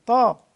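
طا loud with a heavy, full-mouthed ṭ; طاء مفخمة بقوة; emphatic ta. Shown as a demonstration. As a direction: fill the mouth for طا. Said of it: "فالطاء دي مفخمة".

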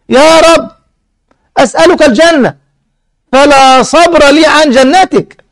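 high, fervent plea, drawn out at the end; intense hopeful pleading. Retold or imitated. Servant begs God for Paradise, intensely pleading. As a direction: beg as if unable to wait. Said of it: "شوف شدة الرجاء هنا بقى أهو".

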